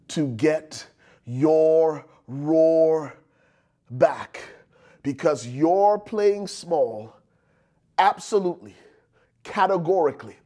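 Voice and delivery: word by word, with long pauses; very deliberate pulpit emphasis; slow, weighty preaching. Real, not acted. Preacher lands his main point.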